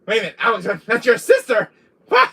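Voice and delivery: loud, rising disbelief; mock shock. Learning Alex is the sister, loud disbelief.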